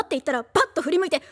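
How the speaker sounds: ordinary talk, nothing marked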